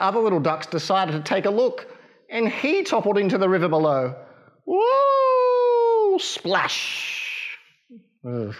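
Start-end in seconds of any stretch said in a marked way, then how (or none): 4.7-6.2 high squeal as he drops; high falling whoop. Acted. Duckling tumbling off the bridge, high squeal. 6.2-7.6 whispered splash. Acted. Duckling hits the water.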